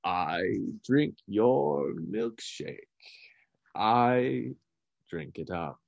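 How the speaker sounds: very slow, dramatic film-villain delivery; Daniel Day-Lewis. Acted. Answering his friend's jibe, slow movie impression. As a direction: stretch each word, pause heavily, sink at the end. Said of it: "sound like Daniel Day-Lewis and There Will Be Blood".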